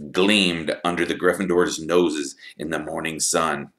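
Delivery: ordinary delivery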